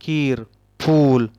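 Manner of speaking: खीर and फूल said slowly, long ई and ऊ held out; stretched long vowels. Shown as a demonstration. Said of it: "दीर्घ लिहावेत".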